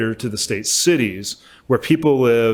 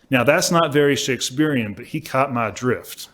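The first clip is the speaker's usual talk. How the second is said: ordinary talk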